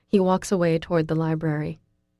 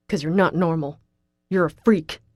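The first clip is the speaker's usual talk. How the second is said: spat out; 3 dB louder than usual. A narrator's reading. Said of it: "he spits out".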